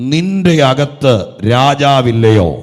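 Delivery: loud, slow, pointed preaching question; emphatic question. Real, not acted. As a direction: press the question home to each listener. Preacher quoting verse emphatically. (ഉറക്കെ സാവധാനം ചോദിക്കുന്ന പ്രസംഗം)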